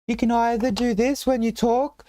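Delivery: very high-pitched falsetto lady voice. Shown as a demonstration. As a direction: squeak it out like a lady, slowly. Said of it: "do the high-pitched noise".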